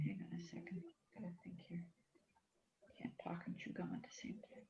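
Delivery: muttered whisper to herself. Real, not acted. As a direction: whisper it under your breath while concentrating. Muttering to herself while fixing.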